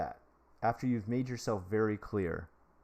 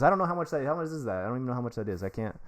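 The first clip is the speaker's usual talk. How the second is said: usual speaking voice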